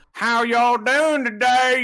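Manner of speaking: slow, high, loud Texan drawl; Texan accent. Retold or imitated. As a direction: lay on a thick Texas drawl, slowly. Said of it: "it's not like".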